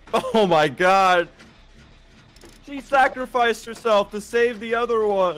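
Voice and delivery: excited shout. Real, not acted. Watching teammate sacrifice herself.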